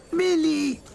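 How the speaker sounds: calling out loud and high from afar; high ringing call of a name; distant shout. A narrator's reading. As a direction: shout his name across the crowd. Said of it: "someone was shouting".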